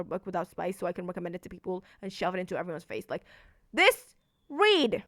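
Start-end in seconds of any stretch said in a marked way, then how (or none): 3.7-5.0 much higher and louder, insisting; excited demand. Real, not acted. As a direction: shout it like shoving the book at them. Pushing the book on everyone.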